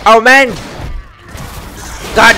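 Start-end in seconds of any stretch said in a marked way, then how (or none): 0.1-0.5 loud frustrated cry. Real, not acted. Overwhelmed in fight.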